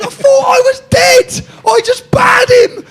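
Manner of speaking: slow, very high, full-volume yell; screaming. Retold or imitated. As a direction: re-enact screaming triumphantly out the window. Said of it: "I'm screaming".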